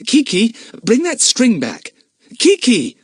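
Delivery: high, loud scolding call. Acted. Mother scolding the parrot, calling loudly. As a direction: call the parrot back sharply.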